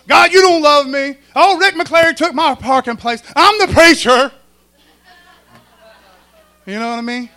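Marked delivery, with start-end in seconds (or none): 0.1-4.3 loud, hammed-up griping at God; mock complaining whine. Preacher mocking a churchgoer griping about parking. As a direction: overplay a whiny, selfish complaint. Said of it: "I'm gonna start griping".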